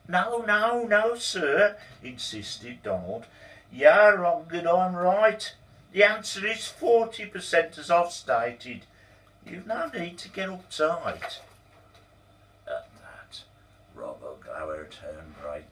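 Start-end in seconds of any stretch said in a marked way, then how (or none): 0.1-11.4 insistent protest. Acted. Donald insisting to the boss he is right, raised voice. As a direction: stand your ground, raised voice.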